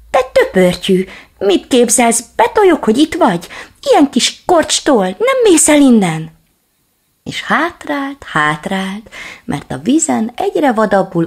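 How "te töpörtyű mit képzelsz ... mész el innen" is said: high, loud shrieking shout; wailing yell. Acted. Little dog shrieks at the growing reflection, high and loud. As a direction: scream it out in a panic. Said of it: "jajveszékelve ordított".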